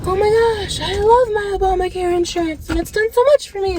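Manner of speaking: hammed-up gushing Obamacare lover; mock gushing fan. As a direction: gush like a starry-eyed fan. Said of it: "people out there who are like".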